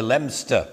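Leominster said as two syllables, LEM-ster; local pronunciation. Shown as a demonstration. Recalling a first visit to the town, Leominster pronounced LEM-ster. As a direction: say Leominster like "Lemster". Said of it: "everybody pronounced it Leominster".

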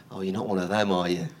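low, soft imitation of a doubtful listener; wary aside. Preacher mimics someone hearing he street preaches, wary aside. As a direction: drop into a quiet, sceptical aside. Voicing a wary passer-by's reaction.

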